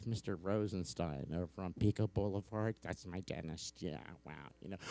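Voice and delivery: ordinary delivery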